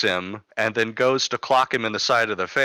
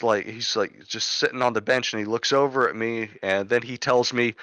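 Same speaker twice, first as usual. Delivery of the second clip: usual speaking voice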